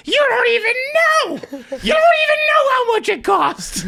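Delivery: high, loud incredulous shout; mock outraged yell. Seatmate shouting that the storyteller doesn't know the water price. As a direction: yell it in shocked disbelief.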